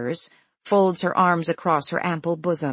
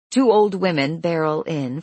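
ordinary delivery, nothing marked

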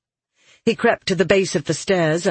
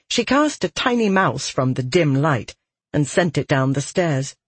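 usual speaking voice

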